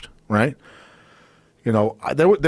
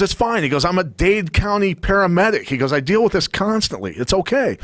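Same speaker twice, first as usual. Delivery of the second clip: higher, mock-soothing voice of a friend; imitating Tom; 7 semitones higher than usual. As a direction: play the calm friend, pitched up. Tom reassuring, Dade County paramedic.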